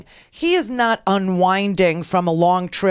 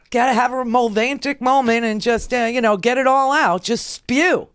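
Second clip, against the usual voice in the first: ordinary talk, nothing marked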